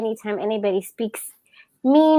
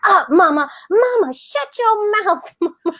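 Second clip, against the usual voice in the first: imitating yelling toddler; 11 semitones higher than usual. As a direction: shriek it like the toddler in the store. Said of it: "my son is going".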